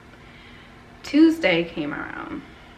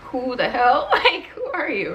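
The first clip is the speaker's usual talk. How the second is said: imitated bewilderment, high and loud; bewildered demand; 6 semitones higher, 6 dB louder, slower than usual. Grandmother not knowing her grandchild on video, bewildered. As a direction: act out baffled non-recognition, pitch leaping up.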